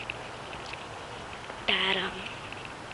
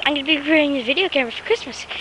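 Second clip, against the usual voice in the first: usual speaking voice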